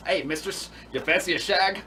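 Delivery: badly faked English pickup line; stupid fake English accent. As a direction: put on a silly English accent to seduce. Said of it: "some fucking kind of stupid fucking accent".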